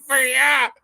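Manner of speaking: high, loud mock shout; mocking catchphrase. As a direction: exaggerate an irritating cashier catchphrase. Mocking irritating fast-food catchphrase.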